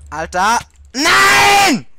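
furious yelling shout; berserk rage. Real, not acted. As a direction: scream out in raging frustration. Gegner knapp verfehlt, Wutschrei. (wütender Schrei, völlig ausgerastet)

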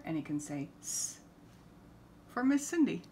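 ordinary delivery, nothing marked

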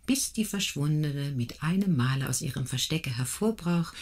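ordinary delivery, nothing marked

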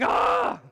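sudden high, loud mock scream of his wife; imitated labor scream. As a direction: shriek it out like she did mid-contraction. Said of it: "Stacy's going".

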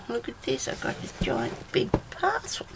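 breathy excited whisper, pitched high; hushed whisper over a big parcel. Real, not acted. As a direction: whisper it like a secret thrill. Excited secret show of parcel.

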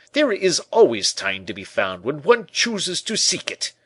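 high, loud, pompous pronouncement; sententious. A narrator's reading. Porthos lectures the tailor on finding time, loud and sententious. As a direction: lay down a maxim with booming self-importance.

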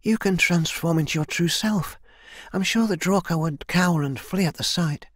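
high-pitched, urging warrior voice; hopeful young heroine. Acted. Kai urges elder to take true form, hopeful. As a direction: urge the elder on in a lifted voice.

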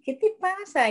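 high-pitched playful challenge; lifted, mock-indignant question; talking to a rat. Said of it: "le digo".

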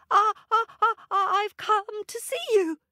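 high, fast stammering monkey; nervous monkey. A narrator's reading. Monkey stammering to Papa God in a high voice. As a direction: stammer out why he came. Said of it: "said Monkey".

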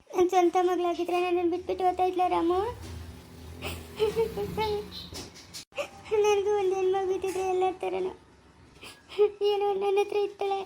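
tearful. Acted. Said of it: "ನೀನು ಅಳ್ಬೇಡ".

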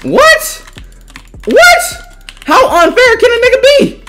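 high squeaky repeated what; can't believe what just happened; shocked high-pitched protest; outraged disbelief. Real, not acted. Disbelief at an unfair fight.

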